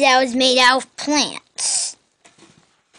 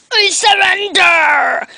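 shouting; 5 semitones higher, 6 dB louder than usual. Pretend. Losing the fight in game.

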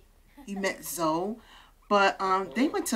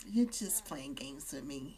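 teasing whisper; 7 semitones lower, 10 dB quieter than usual. Acted. Flirty accusation on date.

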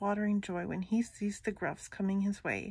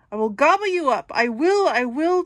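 loud, higher roaring threat; troll bellowing; 6 semitones higher, 9 dB louder than usual. Acted. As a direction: bellow it in a rage. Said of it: "Troll bellows".

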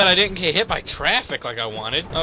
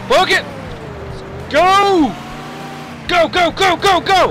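high, loud cheering on; excited urging shout; 8 semitones higher, 4 dB louder than usual. Real, not acted. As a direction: yell it on toward the crash. Urging vehicle on in game.

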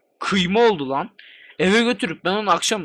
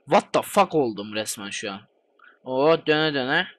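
ordinary talk, nothing marked

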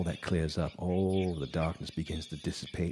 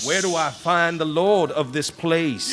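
slow, higher, louder pleading cry; desperate calling; 10 semitones higher, 8 dB louder than usual. Retold or imitated. Man pleading with lost souls in hell, slow desperate cry. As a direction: drag each word out as he begs the dead. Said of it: "I asked".

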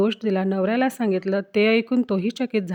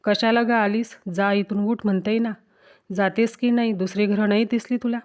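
usual speaking voice

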